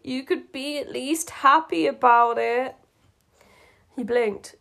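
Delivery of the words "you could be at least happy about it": higher-pitched, harsh complaint; harsh reproach. A narrator's reading. Marceline wants Marshall to be happy, reproaching him harshly. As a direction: scold him, hurt and harsh. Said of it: "she said harshly".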